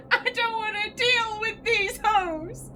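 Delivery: high, slow, whiny fake sob; mock distressed wail. Real, not acted. Player whining at the game, mock crying. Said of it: "I'm so distressed".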